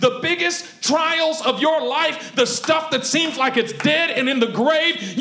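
loud, slow, driving sermon voice; preaching at full force. Acted. Pastor urging the congregation, loud preaching. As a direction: hammer it home to the room.

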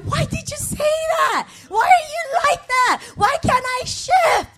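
mock outburst of self-blame; retold shout. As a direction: berate yourself, half laughing. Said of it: "And then I walk in".

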